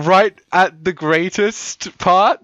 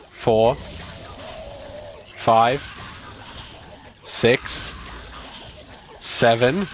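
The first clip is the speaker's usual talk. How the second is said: low, spaced-out count; deliberate counting; 7 semitones lower, 7 dB quieter, slower than usual. Real, not acted. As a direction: count each one off slowly, with long gaps. Counting the defiles one by one.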